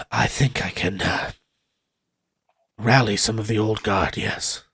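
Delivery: lightly voiced wary soldier, low and quiet; a hint of a hushed soldier. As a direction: answer low and cautious. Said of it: "looks around and says".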